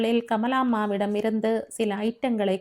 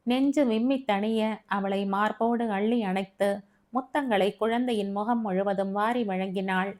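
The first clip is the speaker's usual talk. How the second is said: ordinary delivery, nothing marked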